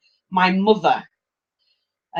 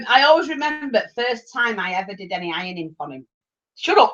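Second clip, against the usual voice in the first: ordinary talk, nothing marked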